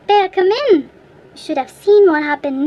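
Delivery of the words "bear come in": a touch less high repeated call; calling out again. A narrator's reading. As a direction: call the bear once more, still urgent. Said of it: "and so he called".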